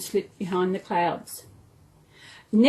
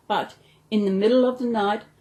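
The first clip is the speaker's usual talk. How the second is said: usual speaking voice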